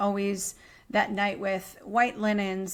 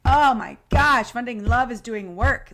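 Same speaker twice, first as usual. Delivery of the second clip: amazed exclamation; 2 semitones higher, 7 dB louder than usual. Retold or imitated. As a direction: marvel out loud at the work. Quoting her own amazement.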